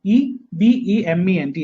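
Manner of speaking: each letter said slowly and separately; teacher spelling out the word slowly. Shown as a demonstration. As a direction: dictate letter by letter. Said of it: "ഐയും ഇയും ശ്രദ്ധിച്ചോണേ".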